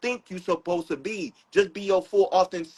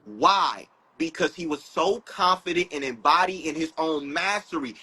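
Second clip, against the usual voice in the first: ordinary talk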